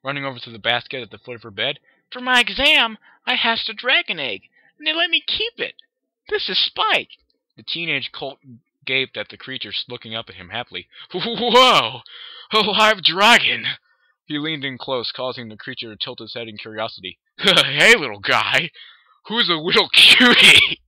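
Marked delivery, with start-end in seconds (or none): 2.1-7.2 very high, loud showing off; excited filly. Acted. Filly shows her dragon. 11.1-13.7 loud, slow amazed gasp. Acted. Colt amazed by dragon. 17.4-20.8 put-on loud, slow crooning baby voice; baby talk. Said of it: "the older brother crooned".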